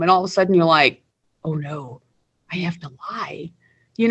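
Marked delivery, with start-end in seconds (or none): 1.4-3.5 much softer, a near-murmured oh no; hushed dread. Retold or imitated. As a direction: say it under your breath like a caught kid. Caught lying to dad, inner panic.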